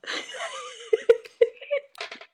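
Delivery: airy squealing laugh; high breathless laugh. Real, not acted. Big laugh after dog joke.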